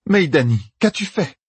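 sharp, high, stunned question. Acted. Sehain to Meidani, high and stunned. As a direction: Sehain gasps out her shock at Meidani. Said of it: "stupéfié".

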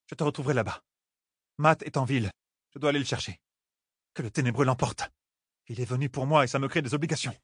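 voicing a worried young man in the story; character voice.

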